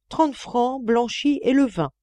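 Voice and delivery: resolute. A narrator's reading. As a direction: stand your ground. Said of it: "d'une voix résolue".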